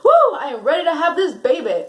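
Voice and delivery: loud, high whoop of excitement; retold adrenaline rush, whooping; ecstatic. Mother-to-be celebrating her pregnancy news, adrenaline-pumped. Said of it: "adrenaline just pumping through my veins".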